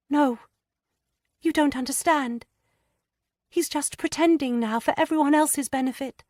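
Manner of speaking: put-on high, hesitant girlish voice, stammering; a frightened young wife struggling to confess; young distressed woman. A narrator's reading. Lydia hesitantly confiding her fears to Anne.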